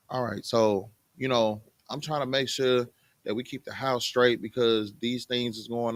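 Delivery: low, softened, reasonable request; calmly explain, coaxing him to help; gentle imitation of a wife asking nicely; considerate wife. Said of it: "if you would come to your husband like".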